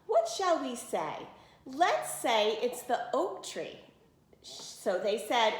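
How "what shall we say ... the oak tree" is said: ordinary delivery, nothing marked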